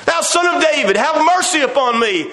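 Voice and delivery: pleading cry. Retold or imitated. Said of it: "He cried more a great deal".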